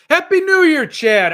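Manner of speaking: hearty shouted New Year wish; loud greeting. Real, not acted. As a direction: sing out a big hello to the viewer. Greeting a chat viewer.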